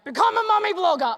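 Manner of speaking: high, loud rallying cry; shouted urging. Pretend. Uterus commanding her to become a mummy blogger. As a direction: bellow it like a mad command.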